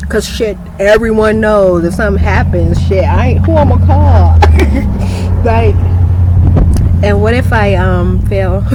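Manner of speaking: loud and worked up. Real, not acted. Telling the camera who she'd call, loud rant. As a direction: shout out the worry. Worked up about falling alone.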